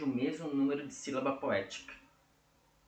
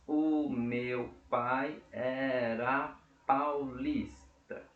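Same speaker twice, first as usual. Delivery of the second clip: very slow, each syllable drawn out; syllable-by-syllable counting; much slower than usual. Shown as a demonstration. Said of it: "vamos contar pra ver".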